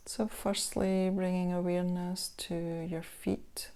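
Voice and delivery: unhurried body-scan guidance with long pauses; guided meditation. Acted. Instructor guiding listeners through a body scan meditation, calm guided meditation voice. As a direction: ease the listener into stillness, leaving space between phrases.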